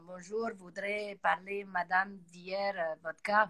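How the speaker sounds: put-on slow, lightly Russian-accented French; imitating a slow Russian accent. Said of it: "la voix bien grave".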